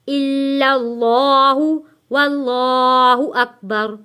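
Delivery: steady Quranic-style recitation with long vowels; devotional recitation. Acted. Recites the full third kalma.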